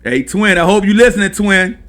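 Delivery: loud call to a friend. Real, not acted. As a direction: shout out to Twin. Calling out to a listener.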